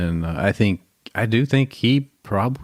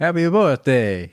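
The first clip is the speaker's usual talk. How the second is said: slow, higher, Italian-sounding attempt at French accent; bad French accent; 5 semitones higher than usual. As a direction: botch a French accent, drawn out. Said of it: "happy birthday with a French accent".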